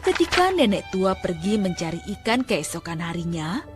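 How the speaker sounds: usual speaking voice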